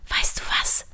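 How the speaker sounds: hushed, voiceless whisper. A narrator's reading. Trini to Line on the blankets, whispered. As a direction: whisper it like a secret. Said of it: "flüsterte Trini".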